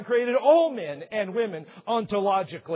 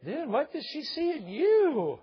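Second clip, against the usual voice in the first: much higher, slow teasing dude voice; buddy's hammed-up disbelief; mocking buddy; 9 semitones higher, slower than usual. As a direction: rib him like a friend who can't believe it. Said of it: "His friends may go".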